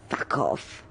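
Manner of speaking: a soft, breathy mock whisper of the swear; retold swear. To a friend joking that the butchers are back, a whispered swear. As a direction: mutter it under your breath like a joke rebuke.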